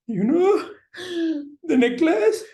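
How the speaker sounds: high, loud, hammed-up crying; acted sobbing. Mother explains to her children, acted sobbing. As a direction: choke out words, then break into wailing. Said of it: "So the mother is crying".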